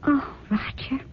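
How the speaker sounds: soft, slow, tearful sigh of his name; touched. Acted. Wife moved to tears by her husband's devotion, soft and slow. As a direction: melt with pride and a catch of tears.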